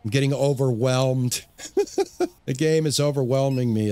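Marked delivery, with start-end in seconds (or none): 1.5-2.4 high burst of laughter. Real, not acted. Overwhelmed by the game.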